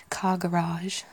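"garage" as ga-RAHZH, stressed at the end; American pronunciation. Shown as a demonstration. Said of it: "particularly in the States".

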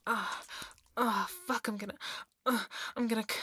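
loud groans breaking up the words; loud urgent moaning. Acted. Speaker to partner, urgent and breathless, moaning loudly. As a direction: groan between broken-off phrases. Acted climax, loud broken moans.

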